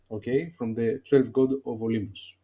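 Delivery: ordinary talk, nothing marked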